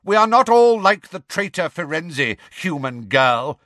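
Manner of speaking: high, loud indignant shout, slowing at the end. A narrator's reading. Grey centaur rebuking the human girl, shouting. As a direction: shout down the plea angrily. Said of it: "shouted the grey centaur".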